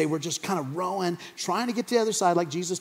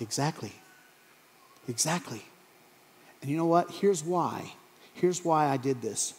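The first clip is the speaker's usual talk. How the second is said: soft, lower, with long pauses; tender reassurance; 4 semitones lower, 7 dB quieter than usual. Acted. Jesus promising disciples he still watches over them, tender reassurance. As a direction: speak quietly and intimately, letting each phrase settle.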